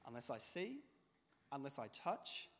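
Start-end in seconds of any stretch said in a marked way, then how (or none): none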